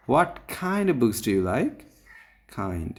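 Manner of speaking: slow question leaning hard on "kind"; stress on Kind. Shown as a demonstration. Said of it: "কাইন্ডের উপরে stress দেওয়া হয়েছে". ("kind" শব্দে জোর দিয়ে)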